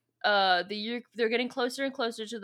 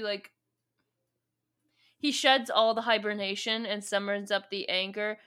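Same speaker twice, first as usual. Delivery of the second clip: ordinary talk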